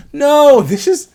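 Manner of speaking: a shocked yelp going way up; high squeal of alarm. Real, not acted. Reacting to Ash attacking Ripley, a high squeal.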